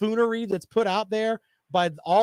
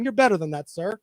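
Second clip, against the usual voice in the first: normal talking voice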